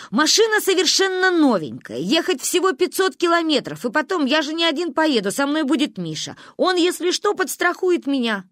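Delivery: high-pitched, insistent arguing; heated. A narrator's reading. Father defending the trip to the women, heated. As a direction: pile up arguments, all worked up. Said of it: "кипятился папа".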